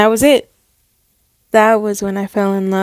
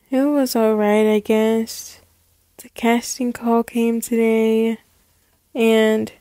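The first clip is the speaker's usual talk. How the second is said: soft, downcast mumble; feigned disappointment; 6 dB quieter than usual. Acted. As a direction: let mom fear bad news. Feigns bad news to mom.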